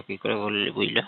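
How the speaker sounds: ordinary talk, nothing marked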